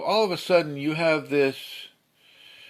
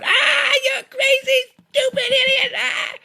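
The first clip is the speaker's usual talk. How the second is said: very high, loud, furious screech; screaming insult; 6 dB louder than usual. Acted. Someone yelling abuse in your face, shrieking. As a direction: scream it at them like a crazed attacker. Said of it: "like someone going".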